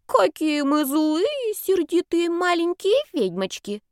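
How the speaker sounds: put-on high, slow cooing baby talk. A narrator's reading. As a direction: coo at her like a toddler. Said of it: "засюсюкала Дарена".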